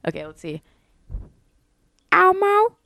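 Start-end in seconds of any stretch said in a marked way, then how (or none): 2.1-2.8 high, squeaky Elmo. Shown as a demonstration. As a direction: chirp it like the red puppet. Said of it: "I can do Elmo".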